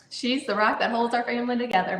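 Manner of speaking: ordinary talk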